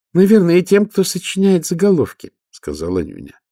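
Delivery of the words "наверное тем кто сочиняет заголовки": high nasal voice. Acted. Nobby speaks.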